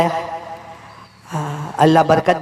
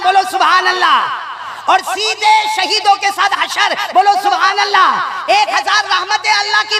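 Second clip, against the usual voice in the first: fiery preaching; 11 semitones higher than usual. Acted. Preacher rousing the crowd to say subhanallah, shouting fervently. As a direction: fire up the congregation, near shouting.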